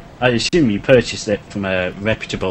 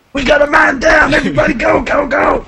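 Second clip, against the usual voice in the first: much higher, loud soldier alarm; man down shout; 7 dB louder than usual. Pretend. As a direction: yell it like a panicked soldier. Mock military alarm.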